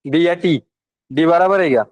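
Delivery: usual speaking voice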